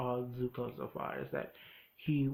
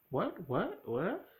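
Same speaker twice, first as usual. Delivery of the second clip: higher, rising repeated disbelief; baffled, each what climbing higher; flabbergasted; 5 semitones higher, much slower than usual. Retold or imitated. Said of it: "flabbergasted. He's like".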